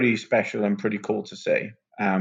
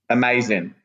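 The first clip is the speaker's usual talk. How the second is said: normal talking voice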